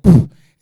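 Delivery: loud, short burst of blown air; mouth-blown spitting noise. Acted. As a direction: blow a sudden loud raspberry-like puff. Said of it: "if you don't go".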